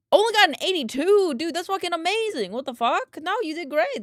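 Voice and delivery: high-pitched, excited disbelief; amazed encouragement. Real, not acted. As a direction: hype them up, astonished at the news. Hyping up viewer's exam score.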